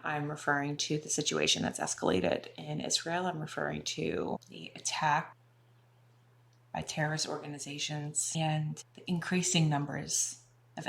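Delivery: soft, subdued voice with heavy pauses; somber. Real, not acted. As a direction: speak softly and gravely about painful news. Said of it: "I have a lot of feelings about it".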